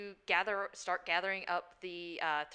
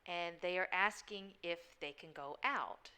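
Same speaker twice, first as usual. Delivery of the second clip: usual speaking voice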